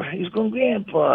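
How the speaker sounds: ordinary talk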